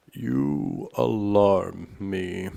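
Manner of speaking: loud, drawn-out alarmed king; zaniepokojony Król. A narrator's reading. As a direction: stretch it out loudly as an alarmed king. Alarmed King speaks in story.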